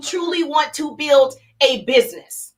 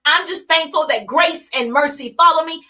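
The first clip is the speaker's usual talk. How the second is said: usual speaking voice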